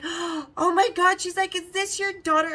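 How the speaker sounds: high, loud gasp of amazed delight; sweet gushing. Retold or imitated. Sales girl mistaking girlfriend for daughter, sweet gushing. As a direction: gush sweetly, pitched well up. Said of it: "she was so sweet".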